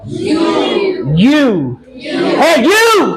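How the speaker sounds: high, loud यू; loud demo U. Shown as a demonstration. Teacher practically shouting the यू for the class. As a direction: belt the यू sound out. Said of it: "उसके बाद".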